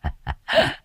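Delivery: low sated laughter; low satisfied cackle. A narrator's reading. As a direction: chuckle low and smug. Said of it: "cười sằng sặc mãn nguyện".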